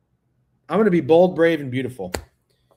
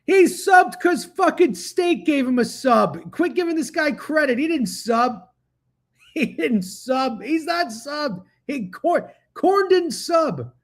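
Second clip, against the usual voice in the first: high-pitched, drawn-out indignation; indignant protest; 8 semitones higher, slower than usual. Real, not acted. Streamer correcting the chat about who subbed, indignant. Arguing with chat over a sub.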